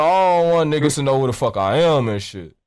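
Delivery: ordinary talk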